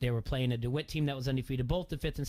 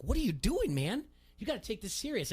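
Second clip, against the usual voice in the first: scolding; 8 semitones higher than usual. Retold or imitated. Dad scolding son off mic.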